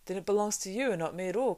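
fast, loud amazed outburst; amazed cry. A narrator's reading. Frodo cries out in amazement to Aragorn, fast and loud. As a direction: blurt it out in sudden amazement. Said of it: "cried O-, Frodo in amazement".

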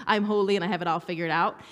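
ordinary delivery

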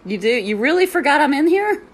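high, loud disbelieving question; rising, loud, can't-believe-it challenge; incredulous retort. Retold or imitated. Retold reply to noisy peer.

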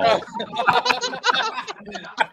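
high-pitched burst of laughter. Real, not acted. Laughing high at the dark joke, high burst of laughter.

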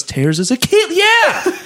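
high squeaky mock reaction; imitating coworker. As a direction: voice the coworker's outburst. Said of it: "and he goes".

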